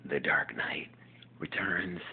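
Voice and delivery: unvoiced whisper to self; whispered aside. Real, not acted. Whispering a note to himself. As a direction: breathe it as a private note.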